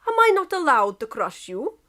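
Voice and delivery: Russian accent, mock innocent. Russia replying innocently to England, Russian accent. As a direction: ask it all innocence. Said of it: "asked Russia innocently".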